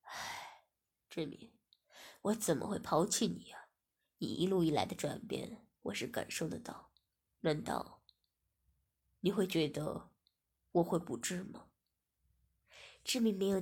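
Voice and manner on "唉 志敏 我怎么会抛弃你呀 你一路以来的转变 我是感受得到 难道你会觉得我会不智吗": sighing, gentle. Acted. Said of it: "叹了口气便说".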